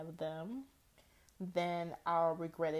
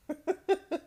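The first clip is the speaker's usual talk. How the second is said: laugh; 12 semitones higher, 4 dB louder than usual. Real, not acted. Laugh after retort.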